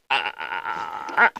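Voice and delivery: a low startled "ah"; dropped low, a caught-off-guard "ah"; startled gasp. Retold or imitated. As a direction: let out a small scared "ah". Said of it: "I think 'cause I was going".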